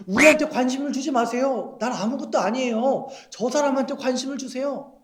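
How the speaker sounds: high-pitched, hammed-up plea to a crowd; mock pleading. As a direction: play a star begging fans to look away. Acting stars begging to be ignored. (높은 목소리로 과장되게 호소하는 연기)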